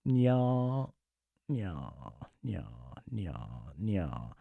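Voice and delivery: repeated catgirl nya sounds; playful catlike nyas for chat; cutesy nya speak. Pretend. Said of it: "I already said nya".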